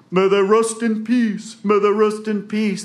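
high, loud, slow holler; yelling out. Acted. Jack calling to a farmer with a cow, high yell. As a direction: holler the blessing up the road. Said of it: "and Jack yells out".

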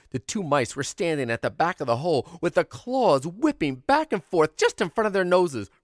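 ordinary delivery

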